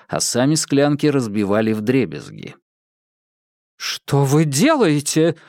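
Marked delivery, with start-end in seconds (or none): 3.8-5.4 exclaiming. Acted. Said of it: "воскликнула Александра".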